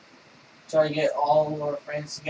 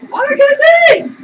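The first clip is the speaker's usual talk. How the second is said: high, loud and strained; loud frustrated shout; 16 dB louder than usual. Real, not acted. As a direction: yell it out in frustration. Frustrated in game.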